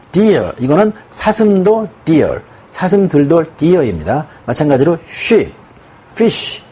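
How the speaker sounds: very slow, 'deer' drawn out; slow explaining. Shown as a demonstration. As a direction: spell it out slowly for students. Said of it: "단수와 복수의 모양이 같은". (천천히 강조하며 설명)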